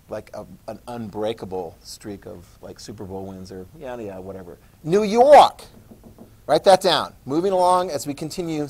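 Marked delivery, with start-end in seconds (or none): none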